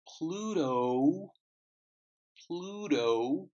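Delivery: "Pluto" with a breathy P, rising to the end; teacher models "Pluto" for students, aspirated P and a rising finish; pronunciation demo. Shown as a demonstration. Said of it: "I know it sounds funny".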